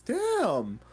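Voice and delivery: impressed damn. Real, not acted. Reacting to raid.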